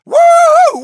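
high, loud cheer; victory whoop. Acted. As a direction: whoop in triumph. Heister cheering a takedown.

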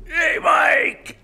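calling out. Real, not acted. Calling out to friend across room.

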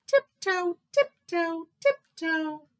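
high, evenly spaced little steps; dainty story-time sneaking; playful tiptoeing. A narrator's reading. Narrator acts tiptoeing.

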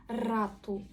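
РАТУ with a Ukrainian-style trilled R; hard rolled R. Shown as a demonstration. Teacher shows older Portuguese, hard rolled РАТУ. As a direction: trill the start of РАТУ firmly. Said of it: "наша тверда українська р".

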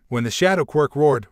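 ordinary delivery

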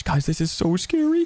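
normal talking voice

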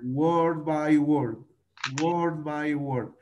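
"Word by word" stretched slowly, word by word; slow model for the class; slow drill. Shown as a demonstration. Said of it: "class drill".

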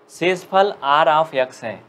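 very slow, drawn-out words; slow dictation. Shown as a demonstration. Teacher dictating the remainder term to students, very slow. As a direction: stretch every word for note-taking. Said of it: "आराम आराम से बोलूँगा".

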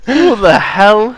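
usual speaking voice

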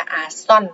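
"xuân" sounded out after a separate "à"; demonstrated pronunciation. Shown as a demonstration. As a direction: model the reading "à, xuân". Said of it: "chúng ta đọc là". (đọc mẫu "à, xuân")

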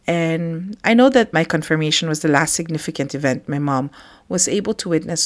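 ordinary talk, nothing marked

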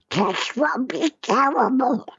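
high, slow squawky cartoon voice; a silly high cartoon duck voice for a gloomy line. Shown as a demonstration. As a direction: say it like Donald Duck. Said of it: "we'll just say it as".

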